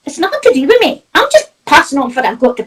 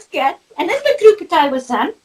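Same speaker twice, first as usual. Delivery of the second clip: ordinary talk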